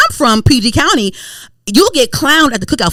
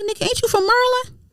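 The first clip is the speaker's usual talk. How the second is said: incredulous high-pitched questioning; 14 semitones higher than usual. Retold or imitated. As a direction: squeal it in disbelief, voice way up high. Acting out own incredulous reaction.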